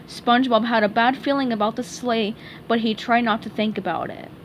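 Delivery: plain reading voice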